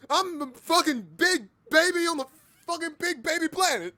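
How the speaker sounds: high mock tantrum; squeaky baby shout. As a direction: squeal it like a big wailing baby. Shouted joke about being baby.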